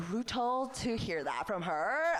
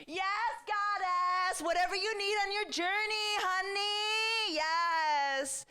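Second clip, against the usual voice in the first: gushing Gen Z fan; 12 semitones higher, slower than usual. Retold or imitated. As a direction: hype her up like a goddess. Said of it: "They're like".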